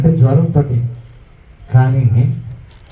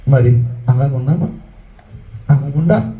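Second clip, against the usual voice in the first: normal talking voice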